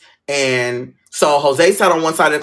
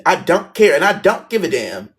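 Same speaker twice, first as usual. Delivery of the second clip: ordinary talk, nothing marked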